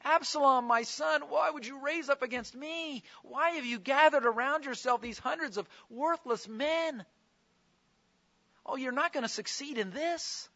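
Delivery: father's high, anguished plea to his son; pleading. Acted. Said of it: "he's pleading with them".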